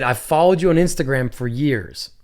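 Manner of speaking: usual speaking voice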